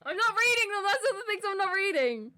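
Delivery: high, loud defiant refusal. Real, not acted. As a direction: shout it back, digging in.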